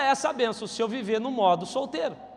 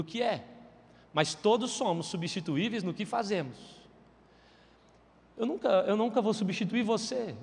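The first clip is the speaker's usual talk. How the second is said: tender divine voice; 3 semitones lower, 6 dB quieter than usual. Retold or imitated. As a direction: speak it gently like God in prayer. God speaking to preacher in prayer.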